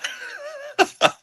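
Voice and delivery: high-pitched burst of laughter; squealed laughter. Real, not acted. Laughs after own reaction.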